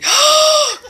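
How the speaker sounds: high gasp of adoration; doting gasp. Real, not acted. Seeing friend's dog, adoring gasp. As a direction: gasp over a cute pet. Coos over a dog on camera.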